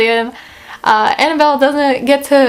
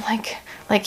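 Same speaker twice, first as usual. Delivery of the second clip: whisper; 11 dB quieter than usual. Real, not acted.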